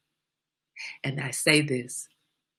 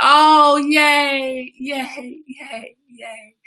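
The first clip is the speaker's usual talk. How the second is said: mock cheer; 8 semitones higher, 7 dB louder, much slower than usual. Real, not acted. Mock cheering at good news.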